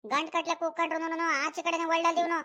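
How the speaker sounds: normal talking voice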